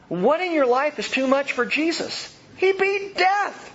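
high, loud, slow proclamation; preacher's climax on Christ beating death, high, slow, loud; rising climax. Real, not acted. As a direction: build to a triumphant cry.

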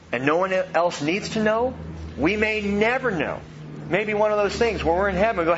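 ordinary talk

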